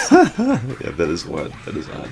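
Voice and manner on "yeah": laughing yeah. Real, not acted. Laughing at odd story.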